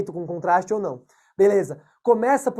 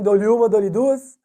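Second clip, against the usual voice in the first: auctioneer; 4 dB louder, slower than usual. Pretend. Closing the floor to answers.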